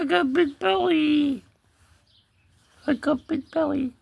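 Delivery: put-on high, childlike-spelled kitty talk; cat voice. Cat explaining his big belly, high cat voice. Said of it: "He said".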